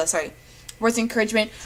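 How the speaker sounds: normal talking voice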